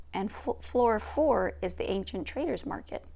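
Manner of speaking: plain reading voice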